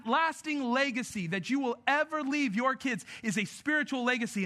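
high, urgent pulpit exhortation; full-force preaching. Real, not acted. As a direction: drive each charge home to the congregation.

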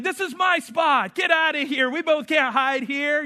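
high, loud mock kid squabble; kids yelling. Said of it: "I even hear them yelling".

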